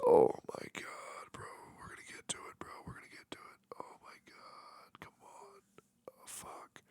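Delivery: whispered, half-crying despair. Pretend. Streamer despairing over room review, tearful whisper. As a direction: melt down under your breath, near tears. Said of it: "My anxiety's through the roof".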